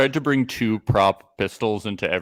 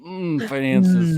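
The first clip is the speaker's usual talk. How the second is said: higher, drawn-out mock moans; mock amorous moaning; 8 semitones higher, 3 dB louder, much slower than usual. Couple turned on by business talk, mock moaning. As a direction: moan it like making out. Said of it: "start making out immediately".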